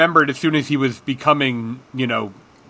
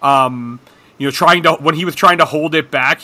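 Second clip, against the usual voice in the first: usual speaking voice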